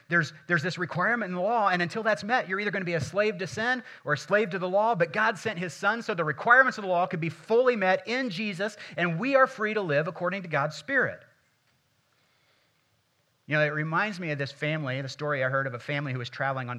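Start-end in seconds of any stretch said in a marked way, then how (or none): 0.1-11.2 loud, high preacher's climax; preaching at full force. Acted. Pastor to congregation, preaching loud and high. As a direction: drive the point home.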